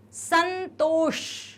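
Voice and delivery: "संतोष" very slow, long ष at end; retroflex final sound. Shown as a demonstration. Teacher giving an example word, "संतोष" slow with retroflex ending. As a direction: stretch each syllable of "संतोष", tongue curled for ष. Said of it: "अंदर को जीभ मुड़ेगी".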